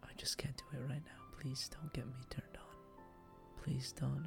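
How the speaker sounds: ordinary talk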